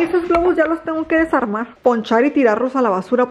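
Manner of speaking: ordinary talk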